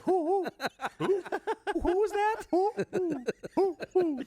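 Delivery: high, laughing hoots of who; squeaky mocking who chant. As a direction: hoot who like a baffled owl. Mocking confused owl-like who.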